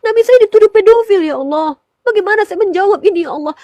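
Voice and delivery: preacher recalls crying out to God in distress; retold tearful prayer. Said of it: "Saya menangis".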